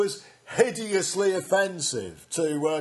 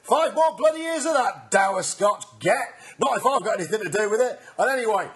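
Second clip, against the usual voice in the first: usual speaking voice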